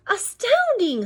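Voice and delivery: high, slow, stretched wonder; acted amazement. Gruffalo impressed by the mouse, high drawn-out amazement. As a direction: marvel with storybook awe. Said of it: "said the Gruffalo".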